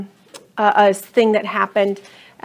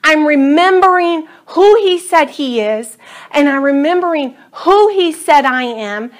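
rising, louder bold prayer of proclamation; prayer declaration; 3 semitones higher, 4 dB louder than usual. Acted. Pastor modeling a prayer for the congregation, bold declaration. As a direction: declare it over yourself, building.

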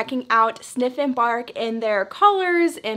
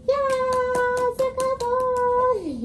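squeaky praising a dog; 12 semitones higher, much slower than usual. Real, not acted.

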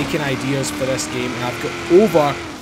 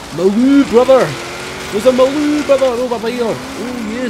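excited; 6 semitones higher, 4 dB louder than usual. Real, not acted. Excited spotting another car.

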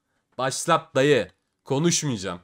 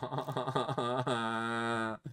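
bitter laugh; 3 semitones lower, 10 dB quieter than usual. Real, not acted. Laughs after losing.

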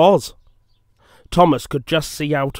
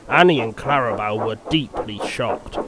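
plain reading voice

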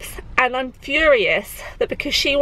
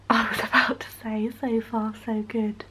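whisper; 7 dB quieter than usual. Real, not acted. Mum whispering near her napping baby. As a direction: breathe it out, no voice. Baby asleep, keeping quiet.

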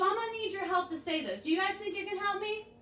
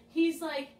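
normal talking voice

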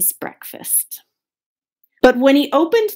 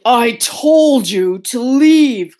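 slow, drawn-out indignant cry; stretched-out exclamation; 6 dB louder, slower than usual. A narrator's reading. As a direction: cry it out slowly as a fed-up bear. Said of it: "cried the bear".

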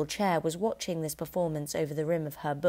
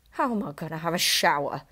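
demanding. A narrator's reading. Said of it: "he demanded".

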